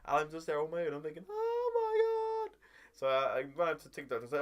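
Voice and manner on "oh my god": very high, drawn-out mock wail; squeaky falsetto lament; hammed-up dismay. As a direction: wail it slowly in exaggerated despair. Retold alarm at being stranded.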